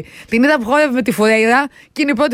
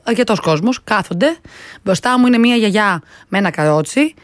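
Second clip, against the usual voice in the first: ordinary talk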